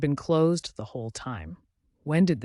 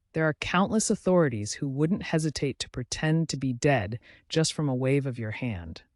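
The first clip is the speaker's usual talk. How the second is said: usual speaking voice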